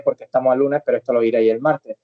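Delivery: normal talking voice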